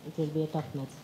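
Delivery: ordinary talk, nothing marked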